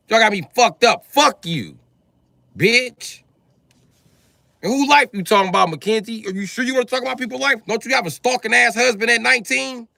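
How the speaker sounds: high, loud furious rant; screaming at chat, high and loud; furious yelling. Real, not acted. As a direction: yell at the troll in rage. Streamer furious at chat troll.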